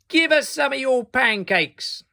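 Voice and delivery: high, loud greedy neighbours; rude demand. Acted. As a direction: demand it rudely. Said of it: "said the rotten lot".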